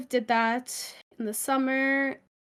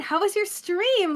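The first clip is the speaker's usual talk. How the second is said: high-pitched sweet customer service voice; 9 semitones higher than usual. Retold or imitated. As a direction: gush like a kindergarten teacher. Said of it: "I find it popping out".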